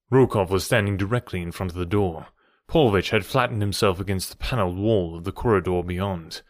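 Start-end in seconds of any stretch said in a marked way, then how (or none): none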